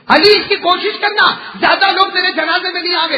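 Fatima's last wish, shouted; impassioned plea. Acted. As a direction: throw the request out with full force. Fatima's dying request, preacher repeats it.

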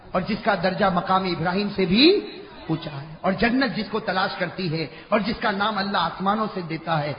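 normal talking voice

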